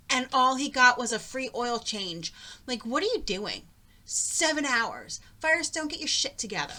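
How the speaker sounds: real frustrated outburst, pitch jumping up; angry rant. Real, not acted. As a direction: let the anger boil over at Firestone. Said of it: "I'm still very angry about it".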